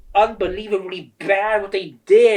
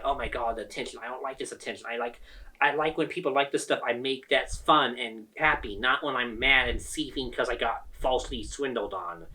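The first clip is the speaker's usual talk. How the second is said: much softer and lower, mumbling nervously; embarrassed quiet murmur; 6 semitones lower, 7 dB quieter than usual. Retold or imitated. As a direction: shrink away from the attention, almost under your breath. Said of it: "I was really embarrassed and kinda nervous".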